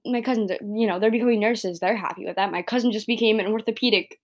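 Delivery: usual speaking voice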